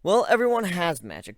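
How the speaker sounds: plain reading voice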